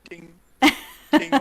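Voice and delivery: high, loud little ding; imitated ping noise. Teammate loves spam pinging, imitates the ding. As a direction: mimic a chiming ping. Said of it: "It's the little ding".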